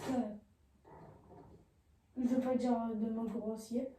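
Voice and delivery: ordinary talk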